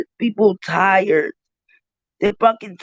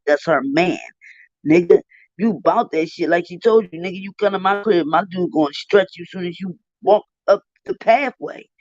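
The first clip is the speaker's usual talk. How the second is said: ordinary talk, nothing marked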